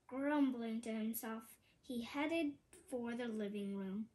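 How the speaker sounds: plain reading voice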